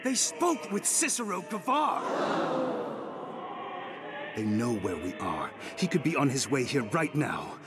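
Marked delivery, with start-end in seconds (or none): 0.0-2.0 high-pitched, urgent protest; alarmed outburst. Acted. Follower confronting the lady's defender over a betrayal, alarmed. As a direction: burst out with it, voice raised high in alarm.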